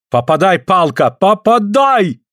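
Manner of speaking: high cry rising to a stretched last word; shouted urging. A narrator's reading. Suль urges Andrei to hit the stick, shouting. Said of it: "кричит Суль".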